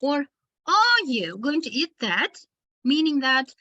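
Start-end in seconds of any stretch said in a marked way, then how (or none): 0.7-2.3 opening "Are" punched and long, rising at the end; stress on ARE. Shown as a demonstration. Teacher urges someone to start eating, stress on "Are". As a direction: hit "Are" hard to prod someone. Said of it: "you want to encourage that person".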